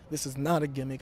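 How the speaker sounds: ordinary talk, nothing marked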